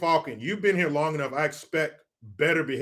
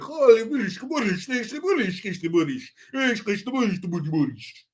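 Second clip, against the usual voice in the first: put-on Russian accent; 6 semitones higher than usual. Russian guys planting documents.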